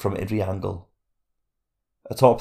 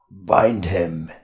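growled; 3 dB louder than usual. A narrator's reading. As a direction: growl the command like a brute. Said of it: "he growled".

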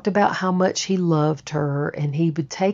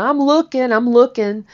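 high, defensive repeated insistence; defensive protest; 8 semitones higher, 3 dB louder than usual. Retold or imitated. Answering the irritated roommate, high and defensive. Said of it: "I'm like".

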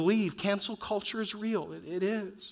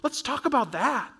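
higher, slow and inviting; offering the scripture; 6 semitones higher, 5 dB louder than usual. Retold or imitated. Pastor voicing himself to a doubting friend, inviting and slow. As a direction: hand them the Bible gently.

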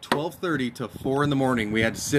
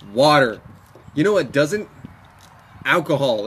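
normal talking voice

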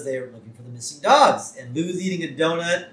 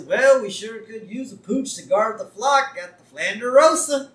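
lightly voiced chirpy neighbour, higher pitch; hammed-up folksy neighbour, pitched up; Ned; 8 semitones higher, 4 dB louder than usual. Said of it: "And so Ned's there".

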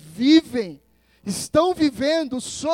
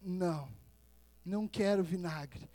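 quiet refusal; 5 semitones lower, 9 dB quieter than usual. Acted. Voices Jesus refusing the vinegar.

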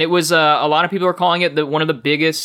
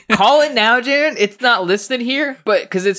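excited; 9 semitones higher, 4 dB louder than usual. Real, not acted. Excited at Danny DeVito Wario idea.

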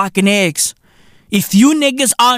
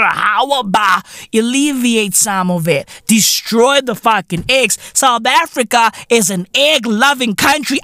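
ordinary talk, nothing marked

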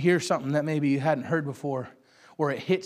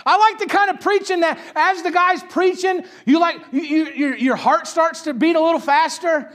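higher, louder preaching that climbs; full-force preaching; 9 semitones higher, 8 dB louder than usual. Real, not acted. Preacher describing God moving in church, at full force. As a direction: preach it hot and rising.

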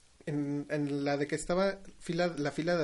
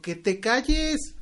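slow, raised, louder telling-off; retold angry shout; 7 semitones higher, 5 dB louder, much slower than usual. Retelling telling off a chatty moviegoer, mock angry shout. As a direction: drag out an exasperated mock yell.

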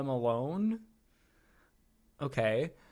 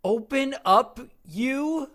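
high, loud mock-awkward coaxing; hammed-up stilted flirting, pitched way up; awkward, timid imitation; 10 semitones higher, 7 dB louder, slower than usual. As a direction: imitate an unsure come-on, exaggerated and high. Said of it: "sounds like she's afraid to talk to him".

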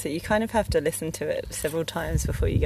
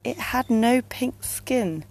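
storytelling verse with lifted line openings; poem reading; 3 semitones higher than usual. Acted. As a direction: read it as a dark little tale in rhyme. Reads a Tim Burton poem.

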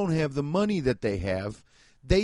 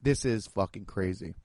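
much lower, softer, awestruck murmur; hushed awe; 8 semitones lower, 5 dB quieter than usual. Retold or imitated. Visitor seeing the Statue of Liberty, hushed awe. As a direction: breathe it out, moved by the sight. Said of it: "that was the thing where I was like".

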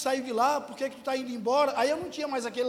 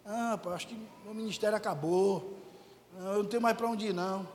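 low, soft retold despair; depressed; 5 semitones lower, 5 dB quieter than usual. Pastor recalling his lament to visitors at home, depressed. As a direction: sink into a low, defeated lament, imitating it. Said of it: "depressivo, chorando".

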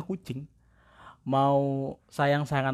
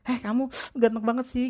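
much higher flattering coo to a cat; 11 semitones higher than usual. Retold or imitated. Girl friend cooing compliments at a street cat, high. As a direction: coo praise at a stray cat.

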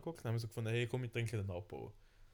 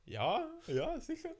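mock eager yes; much slower than usual. Imitating her eager yes in story.

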